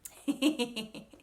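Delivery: real amused little laugh; giggle. Real, not acted. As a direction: giggle lightly. Amused by her slang example.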